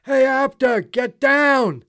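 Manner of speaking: loud, high crowd heckle; retold yell. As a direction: shout it from the ringside seats. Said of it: "people used to yell".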